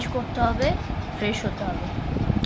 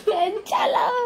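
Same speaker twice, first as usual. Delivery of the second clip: laughing; 11 semitones higher, 4 dB louder than usual. Real, not acted. Laughing-crying after surprise.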